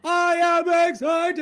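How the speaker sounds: high, loud, slow shout; excited shout. Real, not acted. Host answering his co-host with a loud, high, slow excited shout. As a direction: shout it out with joy. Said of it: "Tell me why you're excited".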